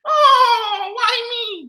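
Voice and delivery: high whiny self-pity; mock whining. Mimicking celebrities whining about their troubles, high and whiny. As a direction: wail it like a spoiled victim. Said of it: "That's what they always ask".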